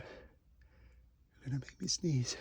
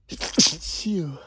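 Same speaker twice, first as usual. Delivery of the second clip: sneeze; 11 dB louder than usual. Acted. Sneeze scene, nose tickled.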